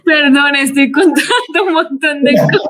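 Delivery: high laugh, then apologising while still laughing; real laughter over her oversharing; laughing apology. Real, not acted. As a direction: apologise through giggles. Laughing at her own confessions.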